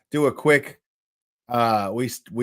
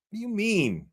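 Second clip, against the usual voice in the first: normal talking voice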